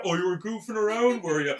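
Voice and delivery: high, loud sarcastic retort; imitated angry taunt; mocking scream. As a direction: throw the excuse back, mock outraged. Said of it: "they're even screaming that".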